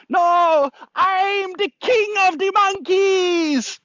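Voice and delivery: very high, slow shouted monkey voice; bad acting. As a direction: shriek it like a bossy monkey king. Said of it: "Excuse my acting".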